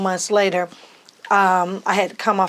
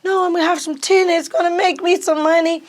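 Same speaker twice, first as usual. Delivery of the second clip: high, louder upbeat insistence; higher, bright eager reply; eager defending; 10 semitones higher, 3 dB louder than usual. Retold or imitated. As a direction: sell dad on the plan. Defending purchase to dad.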